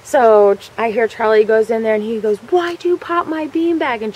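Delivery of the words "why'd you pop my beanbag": slow, high-pitched mock holler; acted angry accusation. Charlie confronting Jennifer over the beanbag, acted angry accusation. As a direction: imitate an irate kid, stretching the words.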